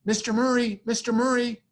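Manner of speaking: high, loud call over the crowd; woman in the audience calling to the host. Retold or imitated. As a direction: shout his name to get his attention. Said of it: "this woman go".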